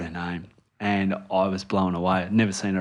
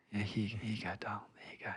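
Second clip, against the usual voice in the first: whispered, half asleep in bed; drowsy dad whispering to his daughter; sleepy whisper; 17 dB quieter, faster than usual. Retold or imitated. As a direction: whisper it so you barely wake.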